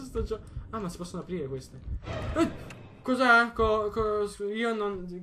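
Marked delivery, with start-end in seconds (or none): 3.0-5.2 startled. Real, not acted. Startled in game.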